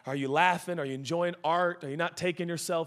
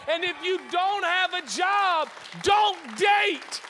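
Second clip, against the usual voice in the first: raised, building pulpit voice; full-force preaching; 11 semitones higher than usual. Real, not acted. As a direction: drive each point home, stretching key words.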